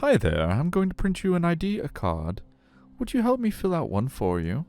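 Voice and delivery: high, sing-song customer-service voice; friendly aquarium guide. Acted. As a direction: greet kids in a high, chirpy guide voice.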